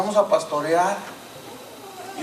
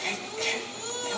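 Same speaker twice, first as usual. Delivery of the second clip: soft, halting imitation of crying; retold tearful wife; 11 dB quieter than usual. Wife moved by the preacher's words, softly tearful. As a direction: stammer it softly, as if welling up. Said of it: "me miraba y empezó a llorar".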